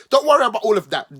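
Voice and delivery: hammed-up don't worry; loud, fast mock dismissal. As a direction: wave it away loudly and quickly. Sarcastic reassurance.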